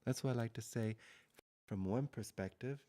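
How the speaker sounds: ordinary delivery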